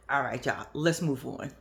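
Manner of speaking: usual speaking voice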